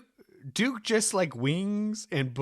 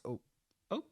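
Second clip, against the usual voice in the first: much softer, fast mutter; 10 semitones lower, 13 dB quieter, much faster than usual. Real, not acted. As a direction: mutter it under your breath. Muttered aside.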